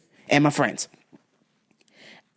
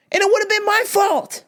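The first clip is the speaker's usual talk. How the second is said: way up high, anguished; high exasperated wail; 13 semitones higher than usual. Retold or imitated. Driver venting after near crash, high exasperated wail. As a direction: wail at how close it was. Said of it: "I'm like".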